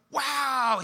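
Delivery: put-on high, loud boyish gasp of delight; a boy thrilled by his birthday card, burst out with delight; excited kid's wow. Boy opening birthday money in car, loud high excited wow. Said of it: "He's, like, super excited".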